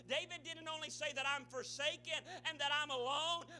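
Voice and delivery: loud, high impassioned sermon; full-force preaching. Real, not acted. Preacher retells David begging the Lord, urgent preaching.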